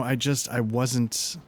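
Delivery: normal talking voice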